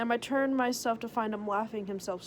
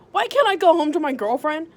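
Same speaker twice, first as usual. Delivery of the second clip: loud pleading question; loudly demanding to go home; raised voice; 6 semitones higher, 11 dB louder than usual. Acted. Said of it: "I asked murkily".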